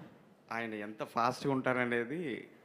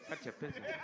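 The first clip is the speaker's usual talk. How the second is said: very soft, deferential reply; meek assistant; 11 dB quieter than usual. Retold or imitated. Assistant answers the scolding director, meek. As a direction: imitate a cowed junior answering the boss.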